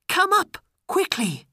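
high, loud, urgent call. A narrator's reading. Princess calls Aladdin up to her rooms, calling out. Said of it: "the princess called".

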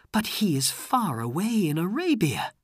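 high, lightly voiced princess; a hint of high-voiced disbelief; princess's doubting thought. A narrator's reading. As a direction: puzzle it over to yourself. Princess doubts what she heard.

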